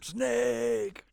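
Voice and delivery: high shrieking yell; screaming. Retold or imitated. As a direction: scream the name in anguish. Screaming game character lines.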